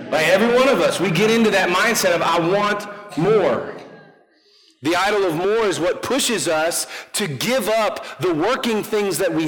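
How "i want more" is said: slow, higher, emphatic craving; greedy wanting. Acted. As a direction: stretch it out with mock greed. Voicing the greedy mindset.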